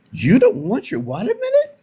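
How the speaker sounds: put-on high, rising German accent for the lunch lady; German lunch lady asking in a high thick accent. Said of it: "she had this thick German accent".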